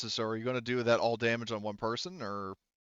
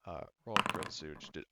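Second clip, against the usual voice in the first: ordinary talk, nothing marked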